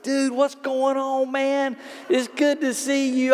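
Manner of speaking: light up at a familiar face; excited greeting. Retold or imitated. To the waiter, a former player recognized, excited greeting.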